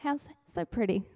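usual speaking voice

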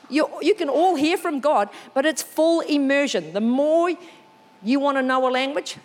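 ordinary delivery

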